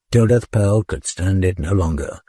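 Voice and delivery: ordinary delivery, nothing marked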